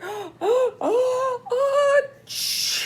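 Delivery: rising high gasps into a big sneeze; oh, oh, then a loud achoo; bear sneeze. Acted. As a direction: ham up a bear's building sneeze. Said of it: "makes the bear".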